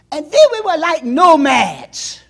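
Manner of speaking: preaching shout. Acted. Pastor reminding the church of nomad days, loud. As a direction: rouse the congregation with it.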